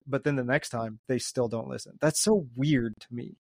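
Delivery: ordinary delivery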